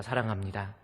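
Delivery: soft, tender thanks to a wife; warm. Shown as a demonstration. Pastor showing husbands how to speak to their wives, warm and soft. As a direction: say it gently and lovingly. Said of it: "따뜻하게 한번 우리 말해봅시다".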